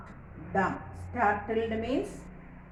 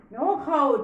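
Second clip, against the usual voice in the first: brisk cry; 5 semitones higher, 8 dB louder than usual. Acted. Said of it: "Tweedledum cried out".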